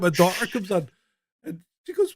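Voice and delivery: ordinary talk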